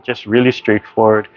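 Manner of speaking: ordinary delivery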